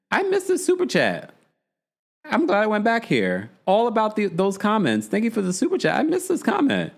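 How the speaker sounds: high-pitched delight at a missed comment; excited. Real, not acted. Thanking a viewer for a super chat, high and excited. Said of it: "found a missed comment".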